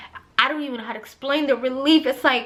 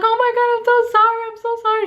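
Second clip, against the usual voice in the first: very fast, much higher, loud gush of sorry; frantic apology; 11 semitones higher, 4 dB louder, much faster than usual. Retold or imitated. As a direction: blurt out mortified apologies. After kicking the doctor.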